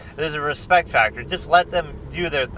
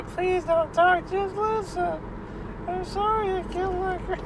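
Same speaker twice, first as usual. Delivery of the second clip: weepy killer imitation; 6 dB quieter than usual. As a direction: whine it out like a tearful killer begging. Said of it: "imitation voice of the weepy voice killer".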